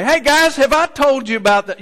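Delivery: high, loud, eager boast; excited boast. Acted. Irving telling the board his story, loud excited boast. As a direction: burst out with the old story.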